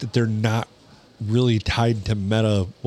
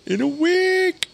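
much higher, slow, put-on voice; mock awe; slower than usual. Cohost echoing the spam claim in a silly slow high voice. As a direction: drawl it out in a silly awed voice.

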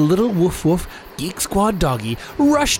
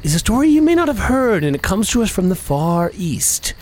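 high, slow dramatic storyteller; horror host; 3 semitones higher, slower than usual. Acted. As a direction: draw out the spooky tale. Ghost story narration.

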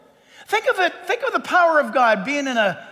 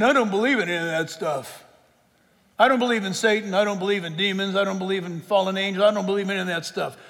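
lower, quicker dismissive skeptic; scoffing skeptic; 4 semitones lower, faster than usual. Retold or imitated. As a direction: rattle off the denials like a scoffer. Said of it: "If you're here today and you're saying".

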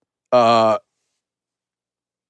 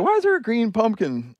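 lightly voiced puzzled old woman, higher and softer; confused old lady; 9 semitones higher, 4 dB quieter than usual. Imagined forgetful neighbor waking puzzled, a light high voice. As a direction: wonder aloud like a muddled grandma. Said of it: "she may wake up ... and be like".

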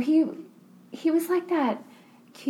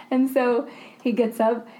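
normal talking voice

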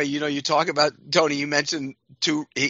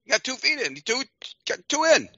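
put-on insistent old linebacker; 9 semitones higher than usual. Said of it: "And he goes".

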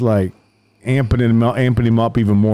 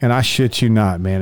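ordinary delivery

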